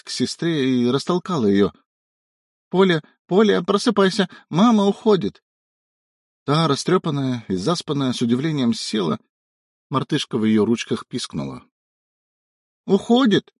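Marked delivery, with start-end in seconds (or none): 2.7-5.4 put-on high, urgent child voice; excited little girl. A narrator's reading. Little girl waking sister. 12.9-13.5 put-on surprised little girl. A narrator's reading.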